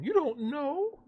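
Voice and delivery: very high fangirl squeak, rising in disbelief; Knives Chow. Retold or imitated. Imitating coworkers shocked at his ignorance, high mock squeal. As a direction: put on an incredulous squeal. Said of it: "They were acting like Knives Chow".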